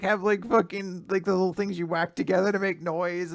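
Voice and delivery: normal talking voice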